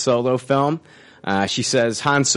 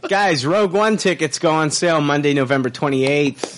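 ordinary talk, nothing marked

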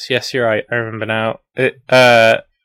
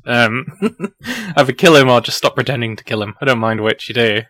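ordinary talk, nothing marked

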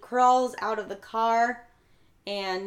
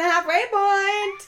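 high, loud, drawn-out announcement; halfway-point voice; 10 semitones higher, 6 dB louder, much slower than usual. Pretend. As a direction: stretch it out in a raised, playful call. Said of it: "I feel like my voice".

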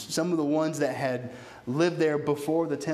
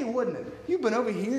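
usual speaking voice

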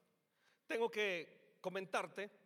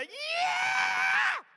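shouted, much higher and louder whoop; ecstatic yell; 11 dB louder than usual. Acted. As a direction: let out a wild burst of a shout. Spirit kicks stuck people.

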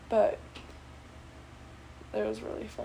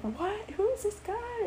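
mock shocked classmates; 11 semitones higher than usual. Classmates surprised by the guy.

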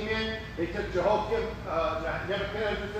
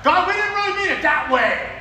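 high, loud alarmed protest; taking it back in a panic; mock horrified; backpedalling pleader; 10 semitones higher, 9 dB louder than usual. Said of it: "starts to take care of it".

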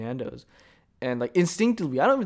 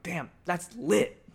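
impressed drawl; 5 semitones higher, much slower than usual. Retold or imitated. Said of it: "I'm like".

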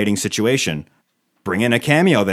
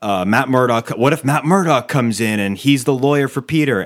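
ordinary talk, nothing marked

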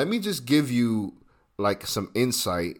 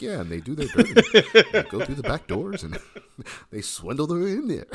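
laughing imitation; 7 semitones higher, 4 dB louder than usual. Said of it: "You're like".

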